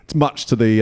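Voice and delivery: ordinary delivery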